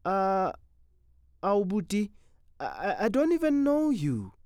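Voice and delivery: high, frightened stammering plea; whimpering. Acted. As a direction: plead with a scared, stammering voice. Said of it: "Then she whimpered".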